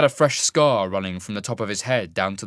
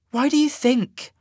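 exasperated; 5 dB louder than usual. A narrator's reading. Sasha exasperated.

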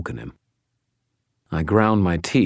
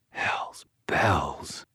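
breathless whispered curse; quiet whisper; 6 dB quieter than usual. A narrator's reading. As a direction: let it out under your breath, voiceless. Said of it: "I said quietly".

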